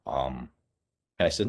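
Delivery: ordinary delivery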